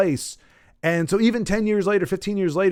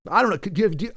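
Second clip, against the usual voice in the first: ordinary talk, nothing marked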